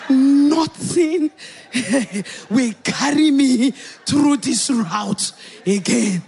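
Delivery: slow, drawn-out declaration; emphatic vow. Retold or imitated. Swearing after birth never to repeat it, emphatic slow vow. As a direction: stretch the words out in mock-solemn refusal.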